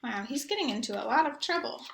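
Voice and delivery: ordinary delivery, nothing marked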